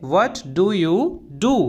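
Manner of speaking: slow, carefully spelled-out English phrase; slow and clear classroom model phrase; slow teaching demo. Shown as a demonstration. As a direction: say each word slowly for the learner. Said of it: "क्या बोलेंगे?".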